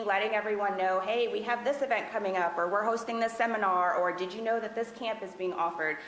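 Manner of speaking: ordinary delivery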